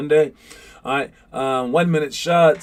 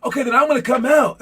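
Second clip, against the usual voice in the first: high, loud cocky dare; taunting shout; 9 semitones higher, 4 dB louder, faster than usual. Retold or imitated. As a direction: call out the bluff, fast at the end. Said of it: "And then he goes".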